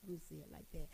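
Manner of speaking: "I'ma say it like that" dropped to a near-murmur, very soft; soft repeat. Shown as a demonstration. Preacher softly repeating her phrasing to women, quiet. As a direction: let it fall away quietly. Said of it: "That's the right way of saying it".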